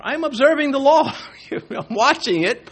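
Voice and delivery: mock spectator. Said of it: "not in the sense of being a spectator".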